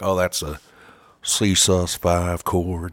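slow, low mock [name] drawl; a lazy hint of [name], dragged out; mild [name] voice. Band guy answering the singer in [name] voice. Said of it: "respond to me with an [name] impersonation".

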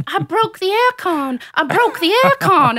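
put-on high-pitched little kid blurting news; loud, high toddler proclamation; child's announcement. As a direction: imitate a three-year-old's high, excited cry. Child's confession acted out.

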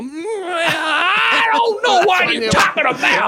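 put-on very high, slow wailing old man; slow it right down, high and wailing; slowed old Joe. Shown as a demonstration. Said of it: "slow it down and go".

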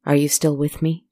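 ordinary delivery, nothing marked

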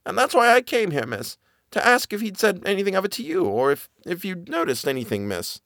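high, urgent servant voice; a scared nanny rushing to warn her mistress; frightened old nanny. Acted. Nanny blurts her warning to Miss Genevieve, high and urgent.

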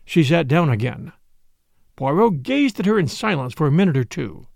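plain reading voice

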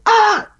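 high, sharp starstruck ah; startled yelp. Retold or imitated. Drummer tapped on the shoulder by [name], high startled yelp. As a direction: yelp in surprise.

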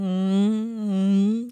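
long droning hummed boat horn; boat sound effect. Pretend. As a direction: hum a boat noise, held steady. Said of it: "That's my boat sound effect".

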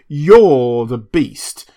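very slow, loud sneer; slow contempt. A narrator's reading. Drunk doctor sneering at Prendick, very slow. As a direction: drag out the insult.

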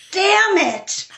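high, comic damn-it groan; mock dismay. Told it's three books, high mock despair. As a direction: play up the mock frustration, pitched high. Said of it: "And I was like".

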